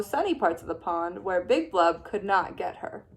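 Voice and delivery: plain reading voice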